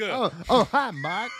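high, loud put-on greeting; hammed-up bad-actor greeting; Tommy Wiseau. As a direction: parody an odd movie line. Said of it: "say it like Japanese Tommy Wiseau".

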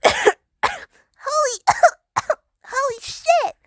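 high, choked exclamation broken by coughs; coughing exclamation. Acted. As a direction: cough between the words, awestruck. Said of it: "hacked once more and finally coughed".

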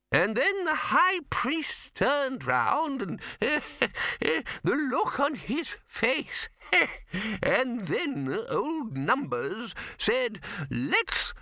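put-on high, slow old wizard chuckling through his story; an old man cracking himself up; laughing old man. Poons ignoring the lifting, giggling high and slow.

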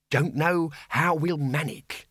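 high, slow, relieved voice; thankful bursar. A narrator's reading. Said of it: "said the bursar, thankfully".

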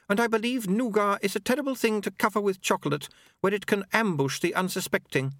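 high, precise woman's voice; Unity, earnest and formal. Acted. Unity discusses the nougat.

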